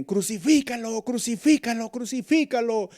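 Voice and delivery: high, loud repeated cry; crowd shout. Retold or imitated. Preacher imitating the crowd demanding the cross, shouting. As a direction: chant it like the mob. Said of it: "más fuerte".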